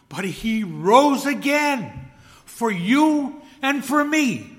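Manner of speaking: slow triumphant preacher's cry; preaching at full force. Acted. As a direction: proclaim it like a shout of victory. Preacher builds to climax.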